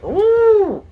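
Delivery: loud, high trumpeting hoot; badly faked elephant trumpet; elephant noise. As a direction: hoot out a clumsy elephant call. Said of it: "did an elephant noise".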